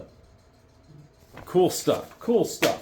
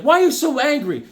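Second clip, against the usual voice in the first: high, loud mock question from the group; puzzled friends asking; 12 semitones higher, 4 dB louder than usual. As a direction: voice the team asking, much higher. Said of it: "everyone's like".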